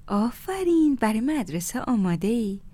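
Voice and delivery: put-on high, sing-song praise for a child; delighted praise. A narrator's reading. Neighbour praising Amir about school, delighted.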